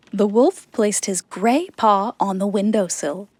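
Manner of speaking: ordinary delivery, nothing marked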